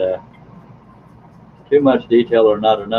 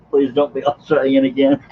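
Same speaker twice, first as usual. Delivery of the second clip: laughing quote; 4 semitones higher than usual. Retold or imitated. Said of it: "She says".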